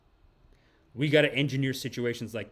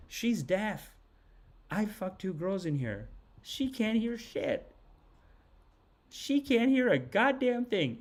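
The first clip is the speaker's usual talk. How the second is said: high mocking voice; 7 semitones higher, 3 dB quieter, slower than usual. Mom is deaf, can't hear.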